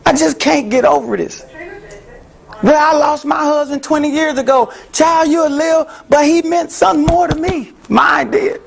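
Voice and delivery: high, pleading grief; grieving plea. Acted. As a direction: plead to be heard in loss. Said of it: "you wanna tell them".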